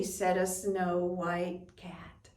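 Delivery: usual speaking voice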